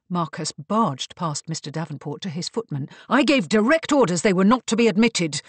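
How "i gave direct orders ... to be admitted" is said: loud, raised-pitch rebuke; angry shout. A narrator's reading. Marcus scolds his footman, shouting angrily. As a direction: shout down a servant who disobeyed. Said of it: "her husband's shout".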